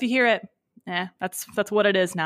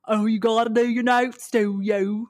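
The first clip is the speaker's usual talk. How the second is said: mock twang. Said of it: "And Clave's like".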